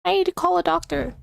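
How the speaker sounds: hammed-up crash scene cry for help; dramatic crash victim. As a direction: gasp it out after the crash. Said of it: "my impression of a car veering off a cliff".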